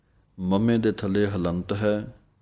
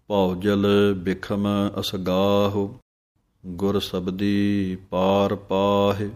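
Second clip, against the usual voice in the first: measured, drawn-out recitation of gurbani; slow devotional recitation; slow scripture recitation; slower than usual. Shown as a demonstration. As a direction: recite each word slowly and clearly. Recites scripture line slowly.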